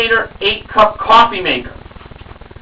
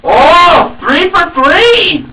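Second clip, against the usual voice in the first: excited; 8 semitones higher, 4 dB louder, much slower than usual. Real, not acted. Excited reaction to correct numbers.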